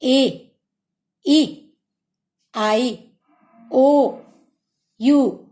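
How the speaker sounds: ए, ई, आई, ओ, यू, each vowel said slowly and clearly with pauses between; teacher showing the vowels for children to copy; vowels said one by one. Shown as a demonstration. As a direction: say each vowel alone with a pause, for the class to repeat. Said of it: "मेरे साथ साथ आप लोग चलिए बोलिए".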